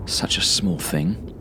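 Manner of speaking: usual speaking voice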